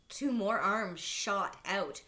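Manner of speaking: ordinary delivery, nothing marked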